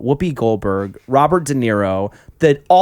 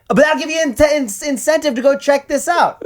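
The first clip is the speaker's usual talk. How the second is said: yelp mid-sentence; 13 semitones higher, 3 dB louder than usual. Real, not acted.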